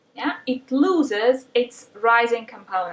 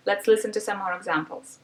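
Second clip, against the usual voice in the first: ordinary talk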